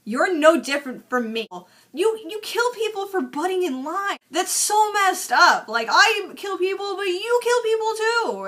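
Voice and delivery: high, pleading mock villain voice; whiny villain. Jacques pleads with Frank, high whiny mock villain. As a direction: whine it up high like a petulant crook.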